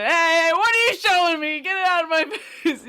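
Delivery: high, loud, fast mock-disgusted voice; quoting the Penguin meme, high mock disgust. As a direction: recoil from something gross, squealing. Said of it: "the meme going around".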